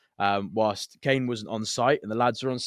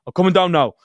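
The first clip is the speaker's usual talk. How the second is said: firm angry reply; 7 semitones higher, 13 dB louder than usual. Retold or imitated. Boss on phone, angry.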